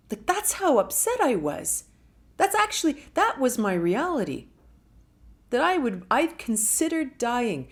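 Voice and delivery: high, loud emotional push; impassioned outburst. Real, not acted. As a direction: let it burst out with feeling. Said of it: "intense emotional confession".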